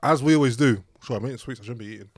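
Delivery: usual speaking voice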